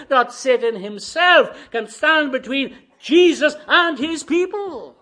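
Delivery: loud, high, impassioned sermon voice; full-force preaching. Real, not acted. Preacher declares to congregation that Jesus is God, full-force preaching. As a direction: proclaim it boldly with long, drawn-out stresses.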